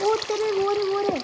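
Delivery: high startled cry; shocked exclamation. Real, not acted. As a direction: gasp it out, shocked. Sudden shock in game. (चौंककर ऊँची आवाज़ में)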